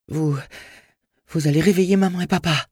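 put-on high-pitched child voice, quickly blurted; little boy speaking up. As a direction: scold the stranger as a frightened little boy. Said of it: "asséna-t-il à l'étranger, trouvant soudain la force de parler".

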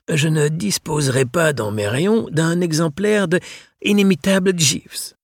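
voix très aiguë et lente; vieux libraire malicieux. Acted. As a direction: souffler la réplique avec espièglerie. (le libraire souffle sa question à Mathias, aigu et lent)

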